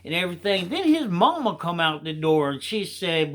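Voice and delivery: plain reading voice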